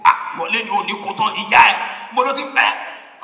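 loud street-fight yelling in Yoruba; fighting shout. Retold or imitated. Area boys fighting naked in Obalende, shouting loud challenges. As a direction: bawl it like an area boy squaring up.

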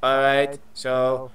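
measured, low-key robot. Acted. Robot asking who the targets are, measured robot voice. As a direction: question like a robot, evenly paced. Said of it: "try and do that robot voice".

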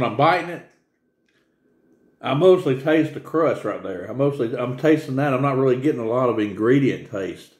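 chewing while talking; talking between bites of pizza; mouth full. Real, not acted. Seen 3: Holds bitten pizza near mouth, lips working while he describes taste.

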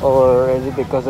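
muffled behind mask and shield; speaking with covered mouth; through a face mask. Real, not acted. Seen 3: Surgical mask and clear face shield worn throughout; references unmasked.

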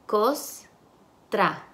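Costra split as "cos... tra" with a pause; syllable by syllable. Shown as a demonstration. Teacher segments the word for students, syllable by syllable. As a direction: break Costra into two separate syllables. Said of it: "Y las sílabas".

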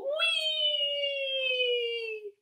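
swooping high whee; high squeal. Pretend. Teacher squeals as frog jumps into pool.

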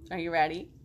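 ordinary delivery

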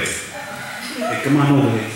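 put-on Italian-American wise-guy accent; Italian mobster preacher. Mobster preacher offers Jesus to a newcomer, Italian-American accent. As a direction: pitch salvation like an Italian mob deal. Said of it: "like to go to the Italian preacher's church".

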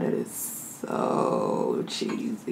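very slow, low, soft swoon; hushed awe. Real, not acted. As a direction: draw it out low and quiet in awe. Savouring the very cheesy food.

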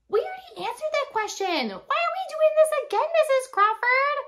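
very high, loud imitated child; kid calling out. Kid objecting to Missus Crawford in class, loud mock-child voice. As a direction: call out like a complaining pupil. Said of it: "one kid calls out and goes".

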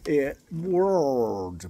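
world with a curled American R; world, careful American R; pronunciation of world. Shown as a demonstration. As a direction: say world with the R rolled back into the vowel. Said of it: "pronúncia difícil pra nós brasileiros".

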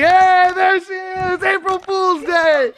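high, slow triumphant yell; excited shout. Real, not acted. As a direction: shout the prank reveal with glee. Prank reveal, shouting.

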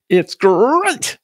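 loud, high, booming cereal-mascot catchphrase; Tony the Tiger. Acted. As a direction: roar it out with a long, punched GREAT. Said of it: "says Tony the Tiger Philosopher Head".

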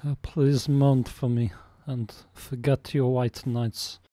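broken-English troll voice, lightly Russian-sounding; a troll hamming up a thick foreign accent; mock foreign accent. A troll propositioning a woman in a mock foreign accent.

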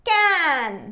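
"క్యాన్" with long vowel, falling pitch; pronunciation demo. Shown as a demonstration. Teacher modelling how it is read, "క్యాన్" drawn out. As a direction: stretch "క్యాన్" as one blended word. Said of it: "క్యాన్గా పలుకుతుంది".

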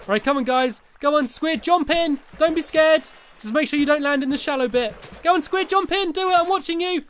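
high-pitched coaxing call; urging on. Real, not acted. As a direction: egg on a hesitant friend. Urging Squid to jump in.

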